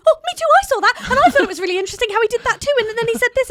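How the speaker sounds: high, loud, rapid gush; re-enacted shouting along, bursting to join in; excited shouting. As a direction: call eagerly at the screen, words tumbling out. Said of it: "I'm shouting at my computer going".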